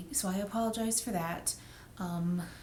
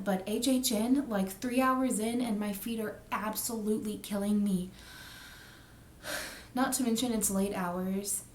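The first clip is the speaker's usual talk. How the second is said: ordinary talk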